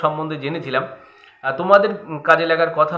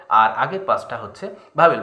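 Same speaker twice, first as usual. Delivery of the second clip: usual speaking voice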